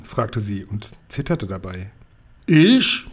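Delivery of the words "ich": loud, high outraged roar; roaring giant. A narrator's reading. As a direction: bellow it so the glasses rattle. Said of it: "brüllte der Riese, so laut".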